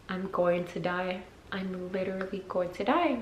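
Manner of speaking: quietly resigned to doom; hushed dread. Retold or imitated. Thinking her sister will find her dead, hushed dread. Her frightened thought on the couch.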